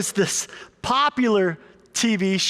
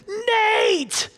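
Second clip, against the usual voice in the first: retold coach shout; 13 semitones higher than usual. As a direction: yell it down the bench, pitched high. Coach yells again from sideline.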